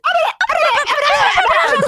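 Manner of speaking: mocking mom. Mom brushing off her chatty kid, laughing babble. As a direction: tune out the kid with a sing-song babble. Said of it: "And she'd just go".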